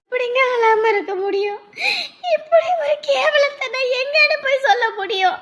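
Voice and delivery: high-pitched wailing protest; despairing, climbing wail; distraught crying. Acted. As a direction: protest through tears at being told to stop. Told not to cry, keeps wailing.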